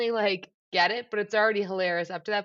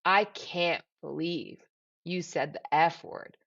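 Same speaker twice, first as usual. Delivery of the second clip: put-on slow, lower, scandalised child voice; shocked little nephew; 3 semitones lower, slower than usual. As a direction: drag it out like a shocked five-year-old. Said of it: "he looks up and goes".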